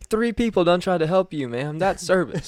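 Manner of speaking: high, loud exasperated boss scolding the customer; indignant retelling. Retold or imitated. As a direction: snap it back like a fed-up boss.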